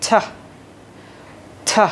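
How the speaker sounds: ঠ said alone with a strong puff; isolated aspirated ঠ, held apart; ঠ, slow separate demo; aspirated T sound. Shown as a demonstration. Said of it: "তাহলে সাউন্ডটা হচ্ছে কি?".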